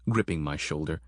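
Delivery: ordinary delivery, nothing marked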